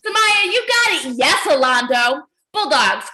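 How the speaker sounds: usual speaking voice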